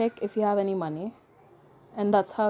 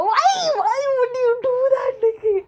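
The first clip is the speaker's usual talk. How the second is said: high, slow, hammed-up crying wail; mock wail; 13 semitones higher, 4 dB louder, slower than usual. A mock tearful plea to Nikki, wailing high. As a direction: wail it like a soap-opera heartbreak.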